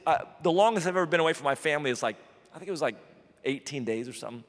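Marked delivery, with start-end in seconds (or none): none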